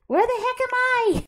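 high-pitched exasperated call; flustered, high and loud. Real, not acted. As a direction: raise the voice in frantic confusion. Searching for own character during fight.

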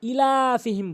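ইলাফিহিম with a short opening i; don't stretch the first vowel of ইলাফিহিম; brief "i" at the start of ইলাফিহিম; common mispronunciation. Shown as a demonstration. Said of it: "দ্বিতীয় number ভুলটি".

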